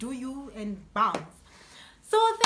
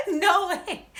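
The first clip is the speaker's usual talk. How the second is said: loud, high laughing no way; laughing disbelief; 8 semitones higher, 8 dB louder than usual. Real, not acted. She relives the theft, laughing in disbelief.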